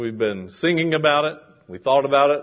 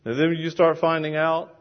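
ordinary talk

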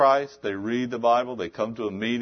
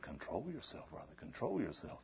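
hushing deacon; 17 dB quieter than usual. Retold or imitated. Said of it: "He said".

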